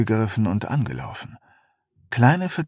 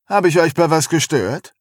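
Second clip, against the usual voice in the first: spöttisch; 5 semitones higher, 4 dB louder than usual. Acted. Kerster taucht spöttisch auf.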